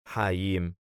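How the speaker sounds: usual speaking voice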